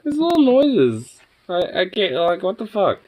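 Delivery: slow high-pitched disbelief; squeaky exasperation. Real, not acted. Exasperated at dog's moaning.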